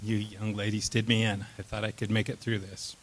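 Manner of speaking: ordinary talk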